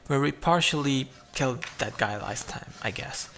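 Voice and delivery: ordinary delivery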